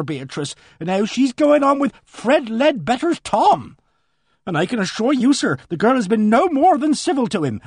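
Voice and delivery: a flustered countrywoman pouring out her news; voiced housekeeper. Mrs Baker continues.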